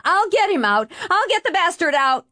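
high, strained angry yell; high-pitched shouting in a struggle; urgent calling out. A narrator's reading. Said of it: "Norm called as he swooped".

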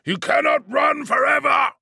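high, loud furious bellow; booming voice. Acted. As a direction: boom it down the tunnels in anger. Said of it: "A booming voice echoed".

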